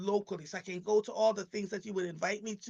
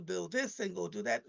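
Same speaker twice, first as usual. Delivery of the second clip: ordinary talk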